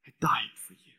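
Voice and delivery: whispered line of Jesus. Acted. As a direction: whisper it with tenderness. Jesus speaking love.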